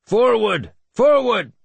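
high, full-force battlefield bellow; bellowed order. A narrator's reading. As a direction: bellow the advance to the whole line. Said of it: "Finan was bellowing the order".

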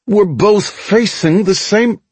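high, slow, exasperated complaint; frustrated groan. A narrator's reading. Soldier answers Romeo's silly question, frustrated slow groan. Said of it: "I groaned in frustration".

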